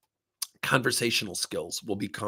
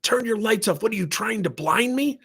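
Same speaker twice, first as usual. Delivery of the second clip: mock angry yell; 11 semitones higher than usual. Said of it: "he starts swearing at me".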